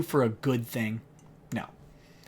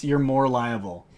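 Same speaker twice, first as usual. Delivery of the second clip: ordinary delivery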